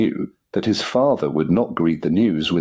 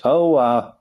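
higher, awkward filler; hesitant stalling; 7 semitones higher, 3 dB louder than usual. A narrator's reading. Son caught out by his father, hesitant. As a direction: stall for time, pitched up. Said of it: "he paused again".

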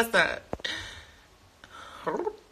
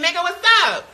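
usual speaking voice

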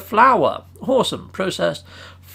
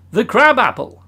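ordinary talk, nothing marked

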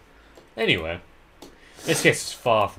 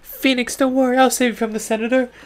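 high character voice; 6 dB louder than usual. Acted. Voicing a character in the game.

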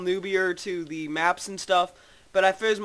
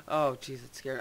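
ordinary talk, nothing marked

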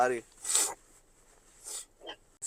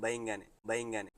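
close to tears; 7 dB louder than usual. Real, not acted. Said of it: "నాకైతే ఏడుపు వస్తుంది".

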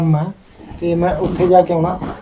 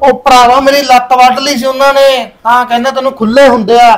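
angry; 5 semitones higher than usual. Acted. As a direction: blurt it out worked up. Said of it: "ਇਹਨਾਂ ਗੁੱਸੇ ਨਾਲ ਬੋਲੀ ਜਾਂਦੇ ਹੋ". (ਭੜਕ ਕੇ ਉੱਚੀ ਬੋਲਣਾ)

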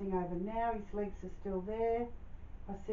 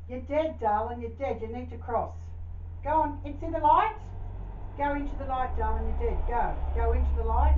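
urgent commands; 2 semitones higher, 5 dB louder than usual. Acted. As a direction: push the spirit out, getting louder each line. Medium orders spirit into light.

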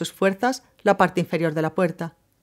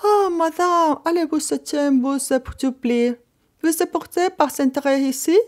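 high, begging voice of a Corsican hotel woman; pleading woman; 8 semitones higher, slower than usual. A narrator's reading. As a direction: plead through the door in a high voice. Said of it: "empezó con tono suplicante". (voz aguda y suplicante de la dueña del hotel)

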